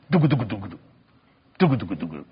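low droning engine noise; mock airplane drone; imitated engine drone. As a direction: hum the plane rumbling, deep and soft.

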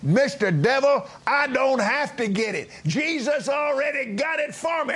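high, exultant near-shout at the devil; triumphant retort. Retold or imitated. Preacher voicing Brother Hagin defying the devil, high triumphant shout. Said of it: "And he said".

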